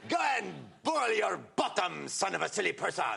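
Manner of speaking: put-on high, slow shouted French accent; screaming French taunter accent, slow and loud. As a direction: hurl insults in a mocking French accent. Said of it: "who says things like".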